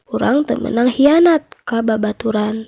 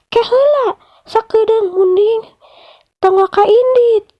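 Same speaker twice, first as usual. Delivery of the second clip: much higher pleading crocodile voice; crocodile calling out; 10 semitones higher, 4 dB louder than usual. Acted. Crocodile stops the buffalo from leaving, high pleading voice. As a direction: call him back, high and urgent. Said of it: "buaya nyarek".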